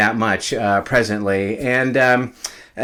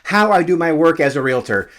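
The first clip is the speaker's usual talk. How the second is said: bright, punchy YouTube host intro; upbeat intro; 5 semitones higher than usual. Acted. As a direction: sell the video with energy. Energetic channel intro pitch.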